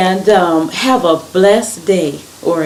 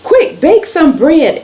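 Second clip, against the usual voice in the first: high-pitched, hurried-sounding order; urgent request; 11 semitones higher, 5 dB louder than usual. A narrator's reading. Abraham tells Sarah to bake for visitors, urgent request. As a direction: urge her to get baking.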